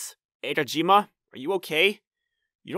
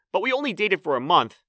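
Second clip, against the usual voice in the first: ordinary delivery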